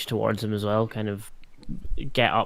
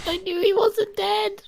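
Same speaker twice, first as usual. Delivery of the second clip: awed character line. Acted. Zig sees familiar face.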